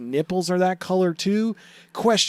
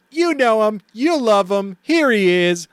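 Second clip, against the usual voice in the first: hype intro; 6 semitones higher than usual. Acted. Hyping up the clown puppet.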